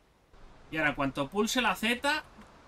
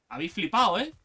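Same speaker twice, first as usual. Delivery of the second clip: ordinary talk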